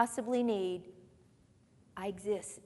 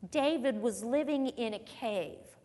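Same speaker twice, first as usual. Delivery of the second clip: ordinary delivery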